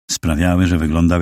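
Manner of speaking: plain reading voice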